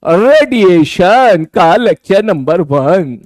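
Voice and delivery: drawn-out words for copying down; slow dictation. Shown as a demonstration. Teacher slowly dictating the lecture heading to students.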